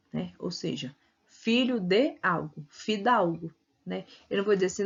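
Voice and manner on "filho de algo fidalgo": "filho de algo" said slowly, word by word; slow teaching demo of "filho de algo"; slow word parts. Shown as a demonstration. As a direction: slowly show the three pieces.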